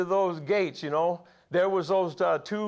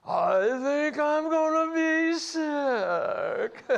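much higher, slow hammed-up whine of nausea with a laugh; mock queasy; 11 semitones higher, much slower than usual. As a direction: play up feeling sick at the top. Mock queasy at top of ride.

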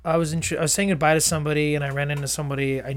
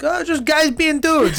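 high, drawn-out mock New Jersey accent; joking New Jersey accent; 11 semitones higher, 4 dB louder, slower than usual. Joking with friends about guys, put-on New Jersey accent. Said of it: "Is it always in that accent?".

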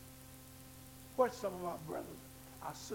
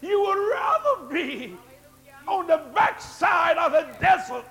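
climbing louder and higher, slowing; building preaching; 6 semitones higher, 12 dB louder than usual. Acted. Pastor describing the shame of debt, in a building sermon cry. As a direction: swell it toward a shout.

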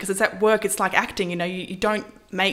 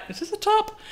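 high, incredulous question; baffled at a too-short dress; squeaky disbelief; mock puzzled. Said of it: "it's like".

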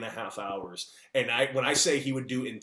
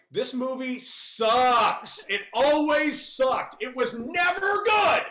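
much higher, louder comic shouting at the room; hammed-up rant; 6 dB louder, slower than usual. To podcast hosts about Field of Dreams, a hammed-up angry shout. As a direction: blow up theatrically at every fan of the movie.

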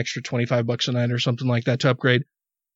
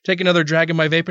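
usual speaking voice

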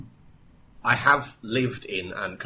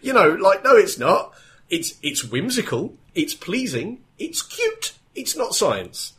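emphatic; 10 semitones higher, 6 dB louder, slower than usual. Real, not acted. Arguing it's not science.

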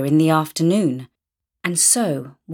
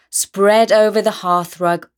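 ordinary delivery, nothing marked

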